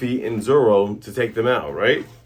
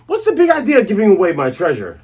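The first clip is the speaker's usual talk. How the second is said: louder, fast, hammed-up outrage; mock angry yell; 6 semitones higher, 4 dB louder, faster than usual. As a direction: bluster like a robbed pirate. Angry pirate voiced in retold scene.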